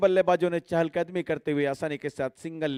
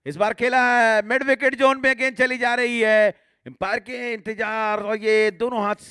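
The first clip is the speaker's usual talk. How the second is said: raised, louder six call; excited cricket commentary; 5 semitones higher, 3 dB louder than usual. Real, not acted. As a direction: build up as the ball flies. Six hit in live commentary.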